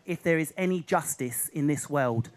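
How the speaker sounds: hammed-up solemn verdict; solemn talent judge. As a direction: pronounce it with mock gravity. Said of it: "One of them went".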